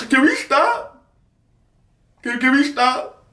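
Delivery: high, whiny begging; begging mom to stop filming; high pleading. Retold or imitated.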